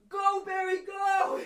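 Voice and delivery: high, loud yell urging a friend to run; urgent shout. Retold or imitated. Acts out urging Barry to flee.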